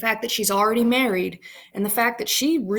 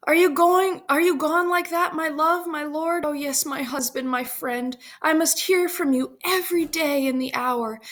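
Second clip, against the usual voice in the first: higher-pitched, lightly acted farewell; parting Juliet; 6 semitones higher than usual. Juliet parting from her husband, lightly pleading.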